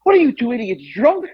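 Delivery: high-pitched mock outrage; acted-out accusing question; scolding dad, imitated. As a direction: imitate a disgusted, incredulous dad.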